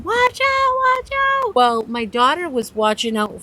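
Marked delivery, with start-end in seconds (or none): 0.0-1.5 high, loud retold scream; screaming a warning. Grandmother on the beach screaming a warning at her daughter. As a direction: yell to warn them off the flying umbrella. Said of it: "And I'm screaming".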